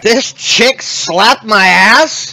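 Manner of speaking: hammed-up chatty creature, laughing; excited creature voice. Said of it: "It's gonna be like".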